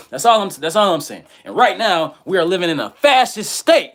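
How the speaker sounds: high, loud, fired-up declaration; heated rant. Real, not acted. Ranting to his viewers, high and loud. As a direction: raise it up and preach it.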